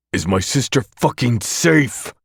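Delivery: bellow. Acted. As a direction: bellow into the phone demanding an answer. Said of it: "I bellow into the phone".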